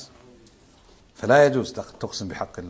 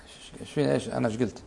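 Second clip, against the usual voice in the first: bewildered mutter; 3 semitones lower, 10 dB quieter, faster than usual. Retold or imitated. Confused man after being scolded.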